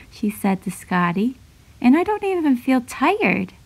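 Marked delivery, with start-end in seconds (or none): 1.8-3.5 put-on higher, lightly excited girl's voice; amazed girl. A narrator's reading. Girl telling her dog she isn't tired, lightly amazed. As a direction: voice her happy disbelief, pitched up.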